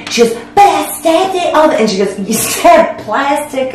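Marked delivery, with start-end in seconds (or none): 0.5-1.7 high, whiny mock protest; protesting sister. Sister defending herself to Mom, protesting. 2.2-3.7 high, slow, drawn-out disbelief; incredulous mom. Retold or imitated. Mom taking in daughter's blunder, incredulous.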